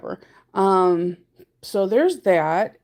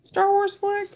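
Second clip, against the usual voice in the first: incredulous; 12 semitones higher than usual. Retold or imitated. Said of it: "There was moments I was like".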